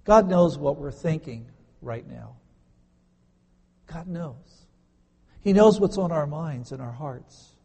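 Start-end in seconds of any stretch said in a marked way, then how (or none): 3.9-4.7 soft repeat. Real, not acted. Pastor hushes to let the truth sink in, quiet. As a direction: drop to a much softer, hushed God knows.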